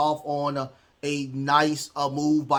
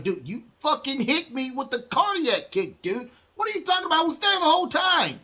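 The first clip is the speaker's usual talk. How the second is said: mock furious rant, pitched way higher; hammed-up outrage at his partner, voice shooting up high; pissed-off [name]; 11 semitones higher than usual. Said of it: "But [name] is truly, truly pissed".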